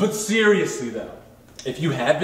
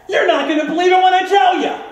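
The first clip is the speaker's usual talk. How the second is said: excited shout; 11 semitones higher, 7 dB louder than usual. Acted. Hyping the price.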